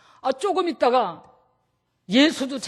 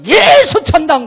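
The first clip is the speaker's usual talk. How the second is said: loud slow street shout; drawn-out evangelist yell; shouted slogan; 5 semitones higher, 12 dB louder, slower than usual. Shown as a demonstration. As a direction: yell it at a passerby. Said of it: "소리라도 질러요".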